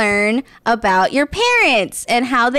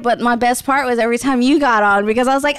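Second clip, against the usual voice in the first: ordinary talk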